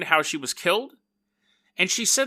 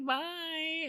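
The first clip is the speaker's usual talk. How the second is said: soft high bye; 11 semitones higher, 11 dB quieter than usual. Acted. Friend waving goodbye.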